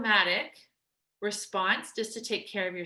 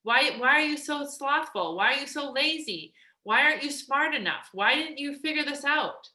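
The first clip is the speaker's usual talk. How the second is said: louder, higher scolding, mock harsh; berating inner critic; 5 semitones higher, 5 dB louder than usual. Coach imitating the harsh inner voice beating yourself up, berating. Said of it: "beat yourself up all the time and say".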